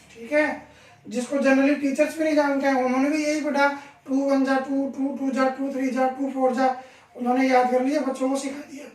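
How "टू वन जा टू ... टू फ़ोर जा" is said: quick run-on "जा" for "are" in the table; wrong pronunciation. Retold or imitated. Teacher echoing what other teachers learned, rote "जा" pronunciation. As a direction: recite "टू टू जा" by rote, words run together. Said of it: "जबकि वो गलत pronunciation है".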